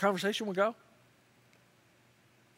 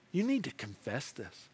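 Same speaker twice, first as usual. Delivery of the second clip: soft, low, sober urging; hushed, earnest admonition; quiet appeal; 7 semitones lower, 6 dB quieter than usual. Acted. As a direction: press them gently, voice dropped. Continues voicing Jesus's quiet appeal.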